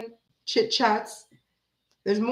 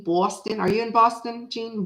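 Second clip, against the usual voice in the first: ordinary talk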